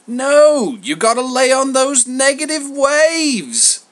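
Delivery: high, loud, whining mock offence; theatrical wounded protest from a schmoozer; mock hurt. A narrator's reading. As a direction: play the wounded pal, hammed up. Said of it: "put on a mock look of hurt".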